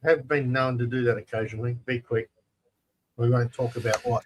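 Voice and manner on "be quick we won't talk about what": normal talking voice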